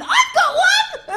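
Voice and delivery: very high, loud drawn-out squeal; hammed-up squealed eureka; excited brain outburst. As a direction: shriek it with mock eagerness. Her brain shouts eagerly.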